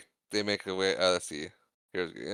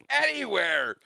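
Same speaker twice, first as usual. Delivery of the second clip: much higher, drawn-out cry; desperate mock yell; 12 semitones higher, 3 dB louder, slower than usual. Luke answering his friends from the water, desperate mock yell. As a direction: yell it like you're being dragged under. Acting out Luke's desperate reply.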